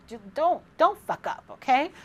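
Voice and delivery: high, sassy warning. Real, not acted. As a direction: warn them with sass. Warning viewers playfully.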